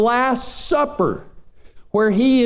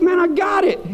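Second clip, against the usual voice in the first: annoyed retort; 9 semitones higher than usual. Retold or imitated. Roommate annoyed at nagging.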